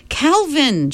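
high urgent gasp; gasped call. A narrator's reading. Meg gasps for Calvin's help as Charles breaks free. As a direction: cry out his name. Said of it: "she gasped".

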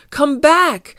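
high, loud cry; calling out. Acted. Garfield to the fleeing Cosmots, calling out. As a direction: call after them as they flee. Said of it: "cried Garfield".

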